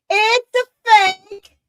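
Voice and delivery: high, loud, drawn-out exclamation; emphatic outburst. Real, not acted. Host hammering home to viewers that NASA is fake, emphatic. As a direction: belt it out slowly for emphasis. Repeats the claim far more forcefully.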